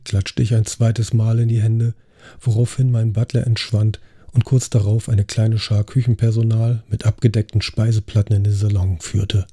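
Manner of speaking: ordinary delivery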